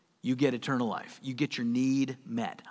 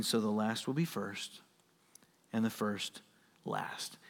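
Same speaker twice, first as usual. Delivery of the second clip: softer, fading to a whisper on last; softer reading; 7 semitones lower, 10 dB quieter than usual. Acted. Preacher closing the verse, fading to a whisper. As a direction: let the verse fall away to a whisper. Sermon verse read quietly.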